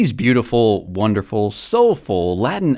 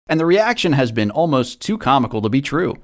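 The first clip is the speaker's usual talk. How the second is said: ordinary talk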